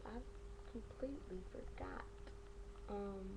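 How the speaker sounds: soft unvoiced whisper; whispered aside. Real, not acted. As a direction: breathe it out as a quiet aside. Whispered aside after losing her thought.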